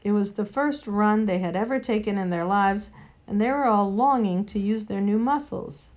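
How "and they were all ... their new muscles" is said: plain reading voice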